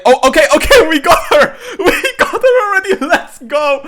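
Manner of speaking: very high, loud whooping shout with laughter; laughing, screaming triumph; ecstatic celebration. Real, not acted. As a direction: erupt in real joy at a lucky win. Gets the character they wanted early.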